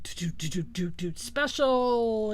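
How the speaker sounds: rhythmic doots into a drawn-out "special"; sing-song mimic of a TV special intro; imitated TV jingle. Vlogger recalls the old network special intro, imitated jingle. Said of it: "That would put up, you know, the".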